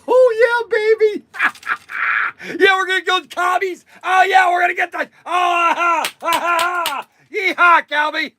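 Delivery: high, loud whoops and cackles; wild whooping. Real, not acted. Playing cowboy with cap guns, whooping high and loud.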